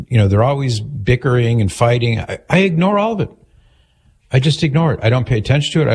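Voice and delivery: normal talking voice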